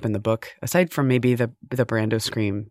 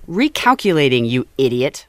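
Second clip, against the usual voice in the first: exasperated GPS; 6 dB louder than usual. Retold or imitated. GPS scolding driver, mock exasperation. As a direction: imitate a GPS fed up with the driver. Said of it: "it sounds like they're saying".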